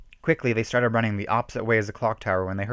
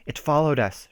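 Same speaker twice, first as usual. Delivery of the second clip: mild shriek; 5 semitones higher than usual. A narrator's reading. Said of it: "shrieked Shannon".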